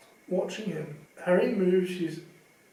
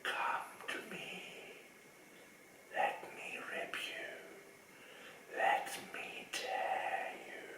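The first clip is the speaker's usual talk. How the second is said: chilling whisper; 15 dB quieter than usual. Acted. A hidden voice threatening Harry, icy whisper. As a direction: hiss it like cold venom in the walls. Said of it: "A voice to chill the bone marrow".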